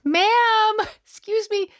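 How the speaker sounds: put-on high, slow little old lady; spry old voice. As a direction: imitate a sweet little old lady asking. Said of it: "little old lady's voice".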